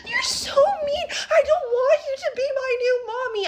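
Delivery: put-on very high, squealed bawling kid; hammed-up tearful tantrum; mock child tantrum. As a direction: wail like a little boy on the floor. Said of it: "throws himself on the ground going".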